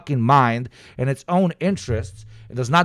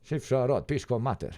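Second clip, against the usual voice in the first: softer mock-Albanian accent; 9 dB quieter than usual. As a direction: toss it off quietly in an Albanian accent. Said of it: "not allowed to do this accent".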